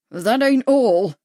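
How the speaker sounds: slow, loud, threatening; menacing. A narrator's reading. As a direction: lean in and threaten him. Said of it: "Murray said menacingly".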